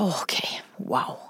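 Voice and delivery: retold inner voice, nearly whispered; hushed stunned thought. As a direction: breathe it out under your breath, stunned.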